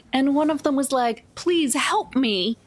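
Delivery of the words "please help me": ordinary delivery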